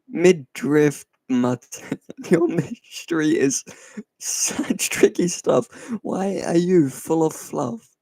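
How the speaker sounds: reading a silly poem while laughing. Real, not acted. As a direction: try to read it through the giggles. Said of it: "I was laughing".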